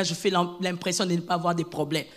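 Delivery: ordinary delivery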